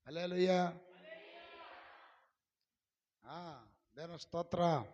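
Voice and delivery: big, slow shouted praise; loud praise shout. Real, not acted. Preacher leading the congregation in loud praise. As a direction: draw the praise out loudly.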